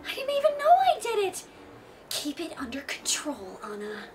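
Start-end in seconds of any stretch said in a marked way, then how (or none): 2.1-4.0 whispered aside to herself; hushed, urgent self-reminder; whispering. Acted. Warning herself under her breath.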